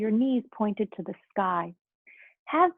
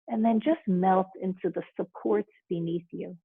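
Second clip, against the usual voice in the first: right in your ear; 3 dB quieter than usual. Acted. Leads a guided relaxation.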